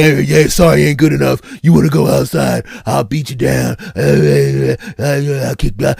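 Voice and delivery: put-on loud slurring drunk threat; mock menacing drunk brute. Drunk guy threatens to fight outside, loud mock menace.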